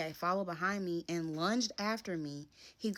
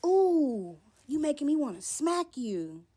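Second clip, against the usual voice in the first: mock threatening man; 9 semitones higher, 8 dB louder, slower than usual. Voicing his threat.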